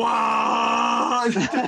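high shouted wow, imitating the truck driver; retold yell of amazement from a passing truck; mock shout. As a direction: belt it out like a startled driver. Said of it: "all I hear is".

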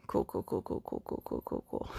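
hushed, voiceless rapid repetition; muttered acceptance. Retold or imitated. Answering her own excuses, whispered mock acceptance. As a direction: whisper it over and over under your breath. Acts out muttered response to herself.

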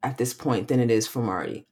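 ordinary talk

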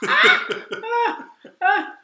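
loud mock moans. Mock orgasm cries.

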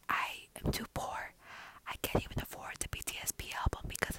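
hushed, secretive whisper. Pretend. To listeners, hiding from [name], a whisper. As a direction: murmur it so nobody overhears. Legal fear, whispered confession.

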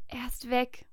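breathless whisper; hoarse whisper. A narrator's reading. Maria to Petrus, shocked, a hoarse whisper. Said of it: "flüstere ich heiser".

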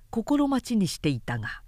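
plain reading voice

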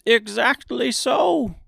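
high, slow delighted agreement; pleased. A narrator's reading. Little man pleased at being called out, delighted voice. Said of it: "as if it pleased him".

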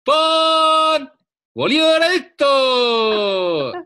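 very high, loud, drawn-out street cry; bread vendor's cry. Retold or imitated. Imitating the new bread seller's street cry, very high and loud. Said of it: "el nuevo que ha pasado aquí es".

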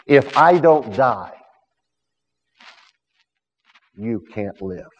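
slow, emphatic preached line fading to a near hush; preached climax. Acted. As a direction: belt the first half, drop the second to a hush. Preached Jesus quote, climax.

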